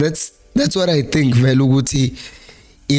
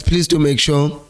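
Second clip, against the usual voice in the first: ordinary talk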